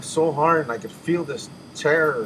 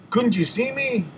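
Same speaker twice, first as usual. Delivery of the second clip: high pleading; 11 semitones higher than usual. Retold or imitated. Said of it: "I said".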